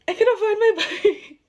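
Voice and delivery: mock-distraught cry with a laugh; half-laughing wail. Telling her sister the bike is gone, half-laughing wail. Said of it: "And then I started crying".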